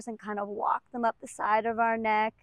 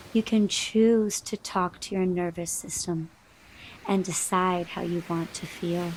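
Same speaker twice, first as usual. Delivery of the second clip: guided meditation; 4 semitones lower than usual. Acted. Yoga teacher settles the class into deep breathing, calm and unhurried. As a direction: ease them gently into deep breathing.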